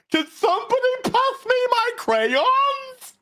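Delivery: high, silly put-on kid voice; squeaky sarcastic simpleton; mock childish voice. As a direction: whine it like a dim little child. Mocks writers as childish.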